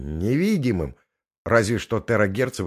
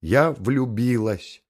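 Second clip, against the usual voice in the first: slow, emphatic and certain; categorical declaration; 5 semitones higher, 4 dB louder, much slower than usual. Acted. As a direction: announce it with no room for doubt. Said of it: "безапелляционно заявила".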